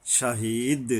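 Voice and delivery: ordinary talk, nothing marked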